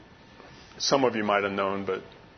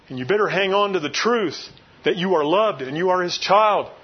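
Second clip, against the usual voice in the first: louder, higher exhortation to the folks; urgent pulpit voice, pitched up and pushing; preaching at full force; 7 semitones higher, 4 dB louder than usual. Acted. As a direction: preach hard, warn them to hang on. Warning congregation to prepare.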